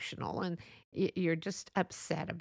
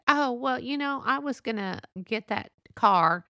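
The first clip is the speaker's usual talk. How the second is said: mock-casual brush-off, higher and louder; dismissive one-upping friend; 5 semitones higher, 6 dB louder than usual. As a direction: play a friend who won't be impressed. Jealous friend downplays your new car.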